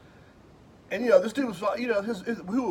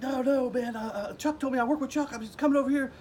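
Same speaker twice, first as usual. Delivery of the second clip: high, panicky stammered explanation; stumbling panic; 10 semitones higher than usual. Retold or imitated. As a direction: tumble over the words, scared stiff. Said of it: "I was stumbling my words".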